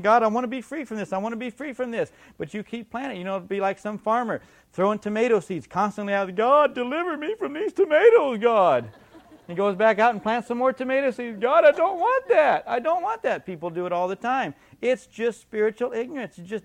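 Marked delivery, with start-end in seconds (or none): 0.0-2.1 whiny pleading. Retold or imitated. Mocking a pleading believer. 6.4-8.9 high, loud whining prayer; whiny mock prayer. Voicing a foolish farmer praying. 11.4-13.4 high, loud whining prayer; whiny mock prayer. Farmer praying again.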